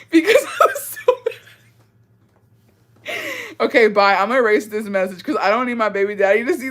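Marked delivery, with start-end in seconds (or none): 0.1-3.6 high squeal of laughter over the words; can barely get it out for laughing; laughing through it. Real, not acted. Laughing while retelling anger.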